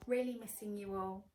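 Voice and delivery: ordinary delivery, nothing marked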